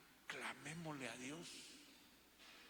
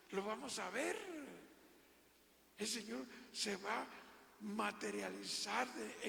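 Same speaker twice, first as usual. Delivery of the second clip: rising, louder preacher's build-up; fervent preaching crescendo; 5 semitones higher, 3 dB louder than usual. Acted. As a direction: lift the congregation with each line.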